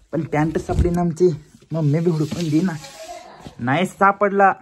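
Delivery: calling loudly to mom across the house. Real, not acted. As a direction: shout for mom from another room. Calling mother to find pants.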